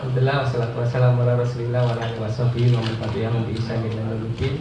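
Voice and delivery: normal talking voice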